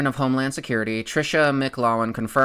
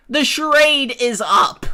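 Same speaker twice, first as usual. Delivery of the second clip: higher, louder, theatrical declaration; louder mock-dramatic repeat; 12 semitones higher, 6 dB louder than usual. Real, not acted. Calling out free-speech hypocrites, theatrical high loud declaration. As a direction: proclaim it like a grand reveal.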